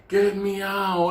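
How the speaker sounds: put-on high, slow, pleading old lady voice; frail old lady plea. Trapped old woman begs the narrator, high and slow. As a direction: beg to be let out, weak and slow.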